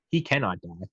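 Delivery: normal talking voice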